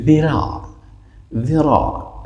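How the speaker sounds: "ذراع" opening on a thin th, high falling end; soft, light dhal. Shown as a demonstration. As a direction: say "ذراع" with a light tongue-tip start. («ذراع» با ذال نرم)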